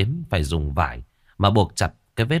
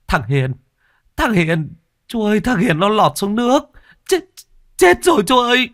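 rising, much higher, louder screams; panicked yelling; 13 semitones higher, 5 dB louder than usual. A narrator's reading. Crewman yelling that Hiền fell overboard, panicked shout. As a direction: cry out in terror, building. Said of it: "bởi tiếng la hét của anh Chín".

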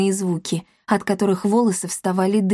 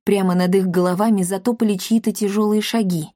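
usual speaking voice

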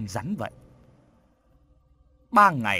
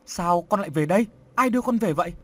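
alarmed mother; 4 semitones higher, 4 dB louder, faster than usual. Acted. Mother startled, scolding crying son.

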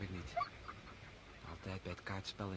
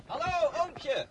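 louder, higher greeting, the last words drawn out slowly; calling out; 11 dB louder, much slower than usual. Acted. Boy spotting his uncle on the hunt, calling out loud. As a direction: hail him across the field.

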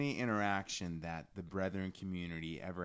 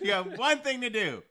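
higher, louder laughing complaint; laughing exasperation; 12 dB louder than usual. Real, not acted. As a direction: throw it out with a laughing shout. Exasperated about atheist pastor.

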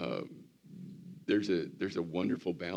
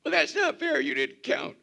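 put-on whiny child protest; 8 dB louder than usual.

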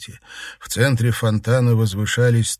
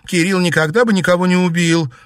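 put-on high, loud girl's protest; heated, insistent denial; 10 semitones higher, 7 dB louder than usual. A narrator's reading. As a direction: defend him with raised voice. Girl protests to the demon.

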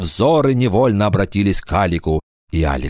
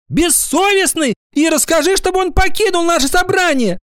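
very high, indignant schoolgirl voice; outraged high-pitched scolding; deeply offended girl's cry. A narrator's reading. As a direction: cry out high, hurt on a friend's behalf. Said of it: "закричала до глубины души оскорблённая".

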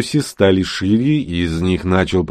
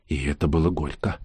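usual speaking voice

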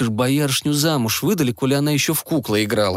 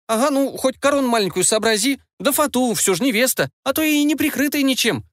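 much higher, indignant and huffy; indignant outburst; 12 semitones higher than usual. A narrator's reading. Frog bride demands a crown and veil from Ivan, indignant. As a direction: protest like an offended bride. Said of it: "возмутилась Васька".

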